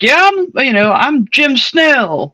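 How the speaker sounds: high, drawn-out mock big shot; hammed-up small-town bigwig, pitched high; mock self-important. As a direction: imitate someone who thinks his name carries weight. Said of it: "like, that meant something".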